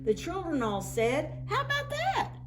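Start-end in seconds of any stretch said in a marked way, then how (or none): 1.5-2.3 put-on very high delighted kids' voice; children's voices. A narrator's reading. The children admire the snowman's outfit, very high. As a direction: chirp it like pleased children.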